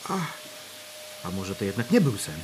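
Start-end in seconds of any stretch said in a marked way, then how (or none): 1.3-2.3 hushed to himself. Acted. Thinking aloud in shock.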